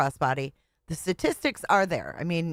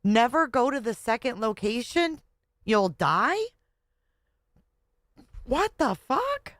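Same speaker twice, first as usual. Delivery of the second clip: high, incredulous questioning; shocked disbelief; 5 semitones higher than usual. Real, not acted. Reactor questioning a mother's warning to teens, shocked disbelief. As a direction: repeat it back aghast, pitch shooting up. Reacts aghast to played clip.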